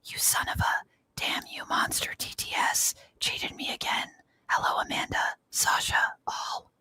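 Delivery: soft ASMR whisper. Shown as a demonstration. Reading a viewer's chat message aloud as an ASMR whisper. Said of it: "you tend to like the A S M R voices".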